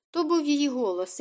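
ordinary delivery

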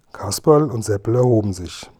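ordinary delivery, nothing marked

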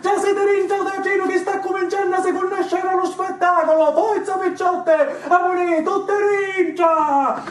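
slow, stretched-out "forza" bellowed high; rallying shout. Acted. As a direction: drive the girls back onstage with a shout. Rallying performers back onstage.

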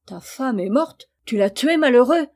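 appalled, rising to a loud high cry on malheureux; shocked outburst. Acted. Father horrified at his son's news, shocked outcry. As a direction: recoil in horror.

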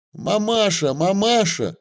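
put-on high, light young girl; girl's voice. A narrator's reading. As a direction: call out to her mother. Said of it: "выскочила".

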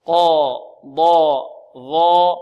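each letter sound held loud and emphatic; drilled letter sounds. Shown as a demonstration. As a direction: sound out qaf, dad, zha loudly. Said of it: "teacher drills letters". (نطق الحروف بقوة وتفخيم)

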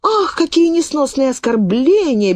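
a high, shrill-pitched exclamation of offence; mock cry of outrage. The maid crying out at the insult, a high mock exclamation. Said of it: "кричит".

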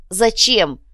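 high, loud anguished question; despairing cry. A narrator's reading. Girl cries out in despair.

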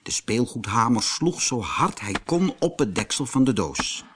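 ordinary delivery, nothing marked

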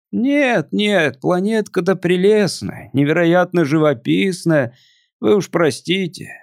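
put-on a melancholy drunk visitor; tipsy alien voice.

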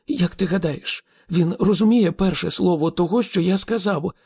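high-pitched, indignant question; trembling with indignation. A narrator's reading. Arthur asks Slartibartfast to back him, indignant. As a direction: appeal for support, mildly outraged. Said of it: "тремтячи від обурення".

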